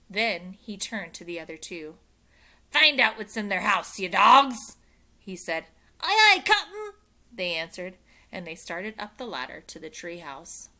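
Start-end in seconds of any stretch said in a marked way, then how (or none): 2.7-4.8 gruff pirate order. Acted. Pirate captain orders crew. 6.0-6.9 pirate crew reply. Acted. Pirates answer captain.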